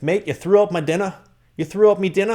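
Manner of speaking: high, loud mock Irish accent. Imitating his Irish roommate in a high mock Irish accent. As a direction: put on a shrill Irish brogue. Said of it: "you gotta work on your Irish accent".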